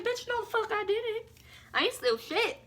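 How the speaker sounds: sharp high-pitched scoff; sassy outrage. Retold or imitated. Accused again, high sassy outraged denial. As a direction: fling it back with attitude. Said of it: "I was like".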